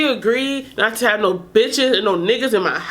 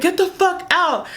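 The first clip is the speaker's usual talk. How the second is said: angry yell; 7 semitones higher than usual. Retold or imitated. Holding knife, ordering him out.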